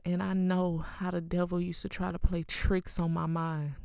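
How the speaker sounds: ordinary talk, nothing marked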